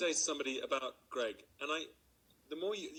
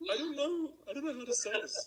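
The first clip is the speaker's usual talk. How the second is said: flustered actor stuck mid-improv, pitched way up; panicked American; 10 semitones higher than usual. Retold or imitated. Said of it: "And I would go".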